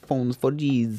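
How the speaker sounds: "Phones for Gs" with Gs run into something like Jesus; "Gs" sounding like "Jesus". Pretend. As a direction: slur "for Gs" so it nearly says Jesus. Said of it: "that sounded more like Jesus".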